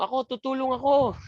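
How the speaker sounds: Marvin volunteering. Acted. Teacher mimics a student offering to help, high-pitched eager voice. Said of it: "Sabi ni Marvin".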